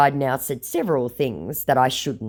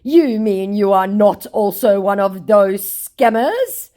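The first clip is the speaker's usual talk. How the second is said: high, slow put-on scammer; mock scammer voice; 7 semitones higher, 7 dB louder, slower than usual. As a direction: play the indignant scammer. Voicing the scammer.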